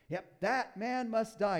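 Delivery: slow, loud, mock-stern verdict; hammed-up furious king, loud and slow; mock death sentence. David mocked passing sentence.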